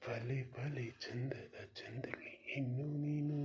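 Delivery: soft, low romantic whisper. Acted. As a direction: breathe the compliment into her ear. Romantic verse whispered to her.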